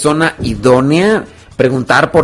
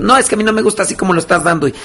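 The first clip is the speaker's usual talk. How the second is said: higher, mounting mock outrage; indignant accusation; 6 semitones higher, faster than usual. Offended person calls their corrector arrogant, indignant. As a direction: throw the blame back, playing the offended one. Acted quarrel, hurling accusations back.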